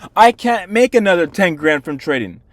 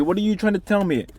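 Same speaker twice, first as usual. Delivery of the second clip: normal talking voice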